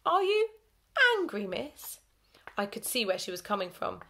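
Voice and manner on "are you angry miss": high, loud wondering question; surprised. A narrator's reading. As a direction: ask in honest surprise. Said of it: "Gamble looked surprised".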